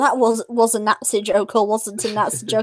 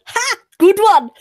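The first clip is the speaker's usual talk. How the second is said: much higher, loud delighted bark; mock German cheer; 10 semitones higher, 5 dB louder, slower than usual. German heckler cheering the comedian's punchline. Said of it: "the German just went".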